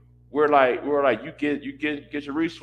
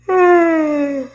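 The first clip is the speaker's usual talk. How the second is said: a long, loud, fed-up groan of a sigh, much higher; loud exasperated sigh; 11 dB louder than usual. Real, not acted. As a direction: let out a big weary sigh, sick of hearing it. Exasperated sigh mid-rant.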